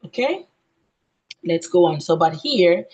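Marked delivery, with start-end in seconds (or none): none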